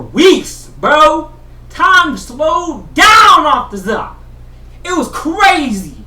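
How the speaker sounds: loud, high and slow; hyped shout; excited shout. Real, not acted. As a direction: yell it out, stretching the words. Shouts about slow time.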